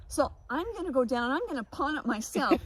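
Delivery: loud, laughing rant at her own car troubles; loud retold rant. To herself about the stolen stereo, a loud laughing vow. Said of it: "so I thought".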